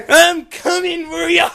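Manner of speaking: "I'm" hit high and loud, shouted threat; loud taunting shout. Acted. Louder repeat of taunt.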